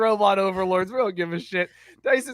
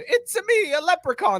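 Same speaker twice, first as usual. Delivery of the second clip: high cartoon leprechaun, Mario-style; 9 semitones higher than usual. Showing co-host a leprechaun impression, high Mario voice. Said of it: "do the Mario voice".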